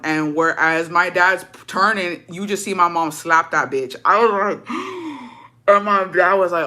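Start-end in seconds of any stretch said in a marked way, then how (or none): 4.7-5.3 sharp high intake of breath; mock gasp of shock. Daughter seeing mom slap someone, shocked gasp.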